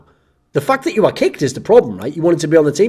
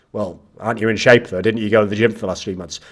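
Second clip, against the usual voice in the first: low reasonable reply; 7 semitones lower than usual. Pretend. Calm voice of reason.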